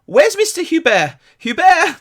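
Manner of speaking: loud high shout for Hubert; calling out. Acted. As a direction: yell across the backstage. Producer calling out backstage.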